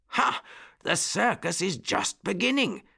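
high-pitched, delighted exclamation; excited discovery. A narrator's reading. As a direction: exclaim to yourself, pitched right up. Bunny delighted at reaching the circus.

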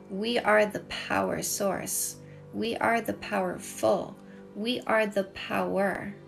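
ordinary talk, nothing marked

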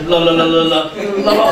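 monotone run of repeated syllables; mock tongues babble. Preacher teasing those who claim tongues, mimicked babbling. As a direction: babble one syllable on a single note.

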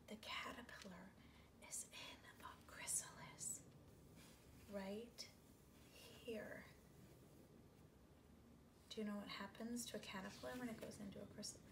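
put-on secretive whisper to the children; hushed conspiratorial whisper.

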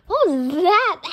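loud, startled question; startled demand. Acted. Reacting to a strange noise, loud startled demand. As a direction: blurt it out loudly in alarm.

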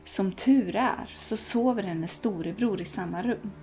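usual speaking voice

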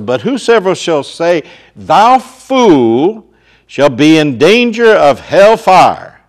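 loud, ringing pulpit voice; booming sermon delivery; full-force preaching. Acted. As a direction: hammer the warning home to the crowd. Preaching Jesus's words at full force.

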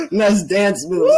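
said slowly through laughter; laughing slowly at a friend's wipeout; laughing tease. Acted. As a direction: tease him while still cracking up. Teasing after wipeout, laughing.

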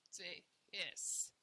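ordinary talk, nothing marked

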